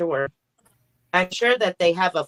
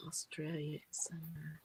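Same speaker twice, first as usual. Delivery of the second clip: soft breathy aside; whisper; 5 semitones lower, 21 dB quieter than usual. Real, not acted. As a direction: whisper it to herself.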